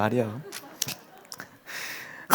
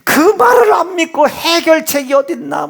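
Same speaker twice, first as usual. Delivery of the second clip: higher and louder, pressing; loud rebuke; 9 semitones higher, 4 dB louder than usual. Real, not acted. Preacher rebuking the congregation, loud. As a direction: challenge them hard.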